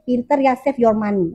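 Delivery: normal talking voice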